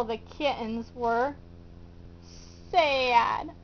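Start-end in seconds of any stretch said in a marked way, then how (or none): none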